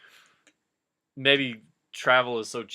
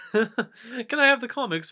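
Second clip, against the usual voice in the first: high, slow and giggling; Frodo voice, laughing; 10 semitones higher, 5 dB louder than usual. Acted. Frodo asks Gandalf again for comics, high and giggly. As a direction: plead sweetly through a laugh.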